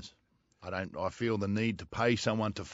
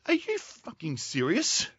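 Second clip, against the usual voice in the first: high and slow, each word stretched; incredulous outburst; 8 semitones higher, slower than usual. Retold or imitated. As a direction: act out the disbelief. Retold outburst at the coach.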